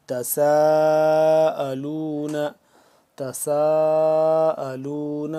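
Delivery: تساءلون with the سا drawn out long; long madd. Shown as a demonstration. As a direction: stretch سا in تساءلون, slow chant. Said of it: "سین الف مد زبر ساءلون".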